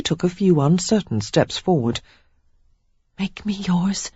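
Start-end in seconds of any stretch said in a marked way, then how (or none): 3.2-4.1 soft murmur, higher; Sarah coaxing her fiancé, soft murmur. A narrator's reading. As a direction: murmur it, barely voiced. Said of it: "Sarah murmured".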